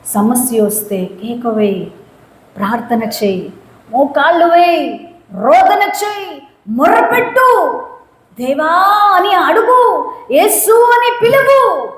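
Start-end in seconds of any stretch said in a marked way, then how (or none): 3.9-11.9 rising higher, building; fervent preaching build; preaching at full force. Acted. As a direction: build the exhortation up. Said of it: "preaching crescendo".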